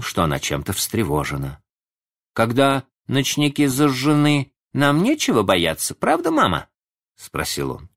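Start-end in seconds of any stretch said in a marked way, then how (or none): 2.3-6.7 put-on high, louder child's voice asking mother; little boy. A narrator's reading. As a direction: pitch it up like a child seeking reassurance. Sleepy little Michael seeks reassurance.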